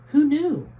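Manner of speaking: Jose marveling at the cave under the city; amazed. Acted. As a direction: marvel out loud.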